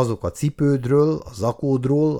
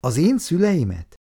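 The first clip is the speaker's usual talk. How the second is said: higher, taken-aback question; astonished question; 6 semitones higher than usual. A narrator's reading. Icu asks the writer in surprise, higher voice. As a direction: caught completely off guard. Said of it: "tátva maradt a szája a meglepetéstől".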